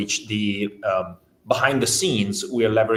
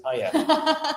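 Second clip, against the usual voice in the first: excited. Real, not acted. Eager yes to who's excited.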